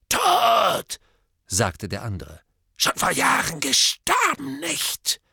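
high, cracked mad voice; a deranged drunken hermit; crazed drunk. Acted. Morphin's voice throughout.

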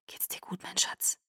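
very soft whispered check; hushed, intimate concern. A narrator's reading. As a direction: whisper tenderly to a loved one.

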